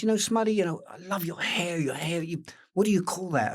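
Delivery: high, gushing compliment; admiring Robert. Retold or imitated. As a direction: coo over his hair. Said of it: "and he goes".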